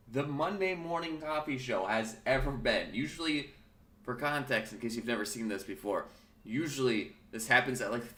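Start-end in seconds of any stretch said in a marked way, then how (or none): none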